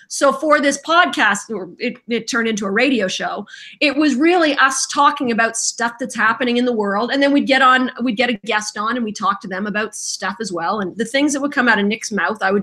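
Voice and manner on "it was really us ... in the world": ordinary talk, nothing marked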